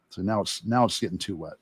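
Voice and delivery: normal talking voice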